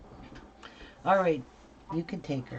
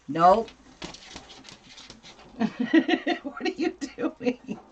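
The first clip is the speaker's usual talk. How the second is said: ordinary talk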